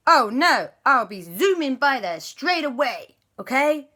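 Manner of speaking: high, loud heroic urgency; eager hero. Acted. Superman promising Aunt Lisa quick help, eager. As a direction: rush to the rescue.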